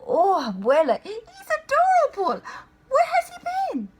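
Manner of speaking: high, loud and delighted; sudden girlish delight; delighted gush. Acted. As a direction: gush over him, thrilled. Smitten cockroach sees mouse.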